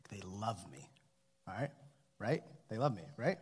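dropped to a soft, low aside; hushed aside. Real, not acted. Pastor quietly fishing for the congregation's reassurance, softly. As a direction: murmur it to the room, half to yourself.

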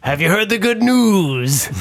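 high, drawn-out proselytizing greeting; hammed-up evangelist. Player tries religion on the dark elf, high and evangelical. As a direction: sing out the question like a missionary.

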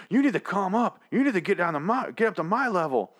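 high, frantic mock rant; frantic neighbor. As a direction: squeal it like a man losing it. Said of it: "he goes".

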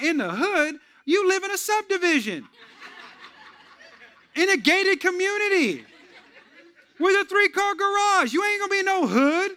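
high, loud laughing disbelief; mocking high exclamations with laughter; incredulous mockery. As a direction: scoff at the suburban tough guy. Mocking reply, laughing preacher.

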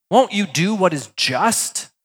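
slow, pointed rebuke; chastising challenge. Acted. Abraham chastises God, slow and pointed. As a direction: lean on each word to scold God. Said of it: "possibly chastising God".

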